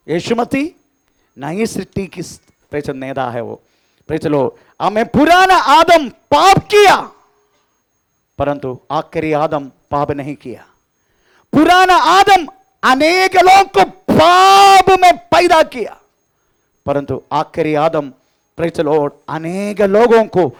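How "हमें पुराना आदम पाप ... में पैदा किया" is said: higher and louder, hammering each phrase to the church; full-force preaching. Acted. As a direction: testify it out to the congregation, loud and high. (ऊँची और ज़ोरदार आवाज़ में प्रचार)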